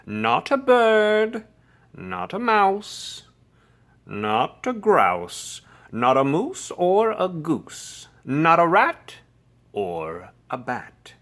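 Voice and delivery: lecturing. A narrator's reading. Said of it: "The lecture went on and on".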